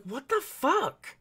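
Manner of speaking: high, slow, stretched-out what the fuck; retold disbelief. Recalling her reaction to the boyfriend's twisting, slow incredulous high.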